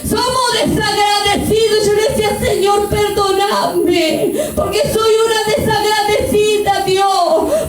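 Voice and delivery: high, drawn-out impassioned cries; full-force preaching. Acted. Preacher confessing the church's ingratitude, full-force preaching. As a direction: hammer each repeated phrase high and long.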